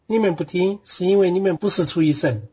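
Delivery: steady verse-by-verse reading with Sichuanese pronunciation and tones; Sichuan dialect. Shown as a demonstration. Bible student reading John for the teacher on request, in Sichuan dialect. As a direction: read scripture aloud in Sichuan dialect. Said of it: "四川话念一念".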